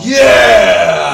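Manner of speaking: loud cheer; big excited yeah. Real, not acted. Cheering a backer.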